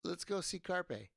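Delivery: rally the chat to go raid; excited. Real, not acted. Repeats the raid call, pumped up.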